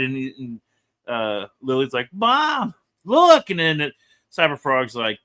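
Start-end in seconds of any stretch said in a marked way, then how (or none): 2.1-3.5 very high-pitched imitation of a child calling out; high mock cry to get Mom's attention. Voicing Lily calling to her mom.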